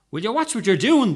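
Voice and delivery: loud, high indignant cry; cried out. A narrator's reading. Young man in the wheelchair crying out at the falling woman. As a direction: yell it at her. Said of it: "the young man cried".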